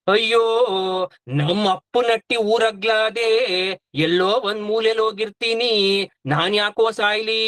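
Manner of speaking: hammed-up lament, every ending stretched; mock wailing. Younger wife backing away from dying, mock wailing.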